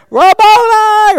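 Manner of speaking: high, loud, slow cry of recognition; joyful drawn-out cry. Acted. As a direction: stretch it out in overwhelming joy. Mary turning to Jesus.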